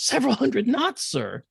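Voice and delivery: high, loud incredulous call; very loud report. Retold or imitated. Incredulous kid reporting to executive officer, high loud shout. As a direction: shout it up high, dropping on "sir". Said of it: "he said it very loud".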